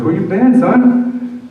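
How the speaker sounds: very high, loud tearful question; emotional priest. Retold or imitated. Priest greeting a returned man through tears, high emotional cry. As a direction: ask it while choking up. Father Greg crying.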